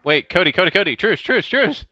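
rising, urgent call-out; urgent pleading. Real, not acted. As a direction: beg for a truce before you get hit. Begging opponent for truce.

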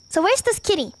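ordinary talk, nothing marked

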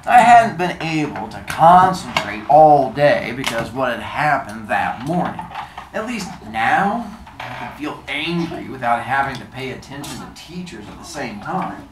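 measured, slow book reading; story narration. Acted. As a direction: read the chapter to the class. Teacher reads novel aloud.

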